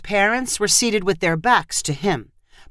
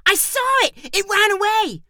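put-on high, thrilled little girl; excited scream; 14 semitones higher than usual. A narrator's reading. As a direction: shriek it out as a delighted sister. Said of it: "one of Jagger's sisters screamed".

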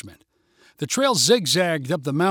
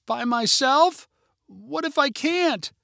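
frightened; 10 semitones higher, slower than usual. A narrator's reading. Frightened girl asks father.

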